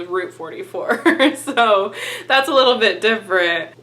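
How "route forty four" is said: "Route" said with an OO vowel; "Route forty-four", Route rhyming with boot; route like root. Shown as a demonstration. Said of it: "that's just what it was called".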